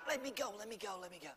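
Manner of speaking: tiny, very soft trapped voice; mock muffled scream. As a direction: plead faintly as if squeezed in a fist. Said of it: "that ten dollars is screaming".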